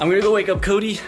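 ordinary delivery

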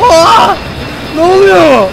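loud, high startled cry; shocked shout. Real, not acted. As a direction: blurt it out in shock. Sudden shock at game event. (şaşkın bağırış)

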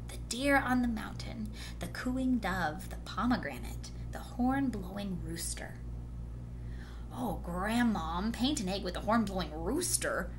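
ordinary delivery

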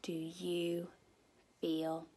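drawn-out storybook title; hushed, drawn-out title read; slow title reading. A narrator's reading. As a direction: read the title slowly to little ones. Reads book title slowly.

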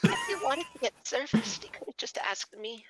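high, then breathy whispered come-on; flirty voice. Acted. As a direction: tease them with a sultry whisper. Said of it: "what the fuck was that voice".

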